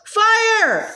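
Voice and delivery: sudden high shout of alarm; yelled. A narrator's reading. Boy spots the flames and yells fire, high alarmed shout. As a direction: cry out to warn of the fire. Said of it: "Sandy yelled".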